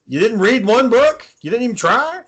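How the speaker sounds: high-pitched incredulous questions; mock disbelief. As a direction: challenge him, voice jumping up. Mock disbelief at Luke's answer.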